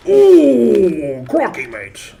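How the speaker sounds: long loud high "ooh", mock Aussie; hammed-up accent slipping into Australian; British attempt turned Australian. Streamer trying British but sounding too Australian, hammed-up Aussie accent. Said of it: "Crocky is just too Australian".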